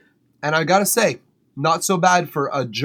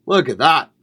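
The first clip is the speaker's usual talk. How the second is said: excited; 6 dB louder than usual. Real, not acted. Showing off his plate of food.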